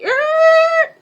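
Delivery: high squealing tire screech; truck screech. Retold or imitated. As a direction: mimic a truck braking hard. Granny whipping in the truck.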